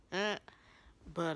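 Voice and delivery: ordinary delivery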